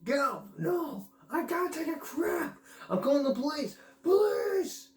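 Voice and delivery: high, frantic shouting back and forth; high yelping cries for the police; acted panicked voices. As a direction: play both sides of a bathroom scuffle in yelps. Acting out bucket scene, voices.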